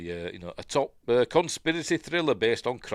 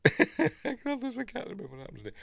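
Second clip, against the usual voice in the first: much higher, laughed-out words; laughing. Real, not acted. Host amused at forgetting the film, laughing through it. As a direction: crack up while saying it.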